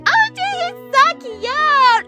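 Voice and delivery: high, slow gushing coo. Acted. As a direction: coo over a cute baby. Gushing over baby Jesus.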